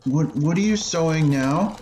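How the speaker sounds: put-on a kid asking his mother about her plans; curious boy. Boy asking his mother about America.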